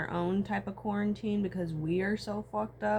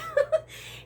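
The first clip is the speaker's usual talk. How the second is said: a quick, high burst of laughter; 5 dB louder than usual. Real, not acted. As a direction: laugh out loud at the joke. Laughing at the story.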